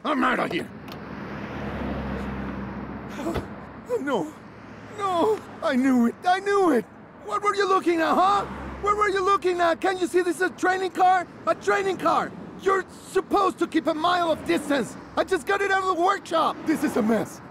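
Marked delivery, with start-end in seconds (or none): 0.7-3.9 long, exasperated sigh; heavy sigh. Acted. Instructor alone after the student leaves: exasperated sigh. 4.0-14.9 furious dismay. Acted. Instructor yelling at student over the damaged car: furious rant. 15.2-16.6 angry shout. Acted. Instructor to student after the crash: angry shout. 16.7-17.4 furious dismay. Acted. Instructor yelling at student over the damaged car: furious rant.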